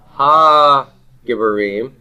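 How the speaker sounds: ha drawn out slowly and rising before giborim; slow model phrase. Shown as a demonstration. Teacher showing the article on giborim, slow and stressed. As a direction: stretch the ha of ha giborim. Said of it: "I have to say".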